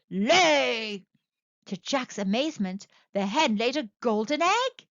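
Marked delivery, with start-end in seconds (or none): 0.1-1.0 loud barked order; giant's command. A narrator's reading. Giant orders the hen, loud shout.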